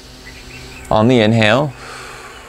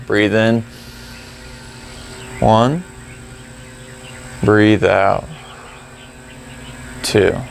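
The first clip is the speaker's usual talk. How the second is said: slow, measured cues with long pauses; guided breath counting; 4 dB quieter, much slower than usual. Shown as a demonstration. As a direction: count the breaths calmly, rising on one, dropping on two. Leads paced breath counting.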